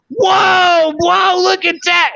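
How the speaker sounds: very high, loud, drawn-out whoa; amazed mock shout. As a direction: belt out a stunned whoa like a kid seeing magic. Retelling Vape's amazed reaction.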